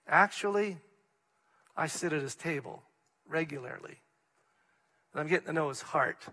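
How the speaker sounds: intimate, reverent near-whisper; quiet sincere answer. Pretend. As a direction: confide it softly, like a close friend of God. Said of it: "Or would you say".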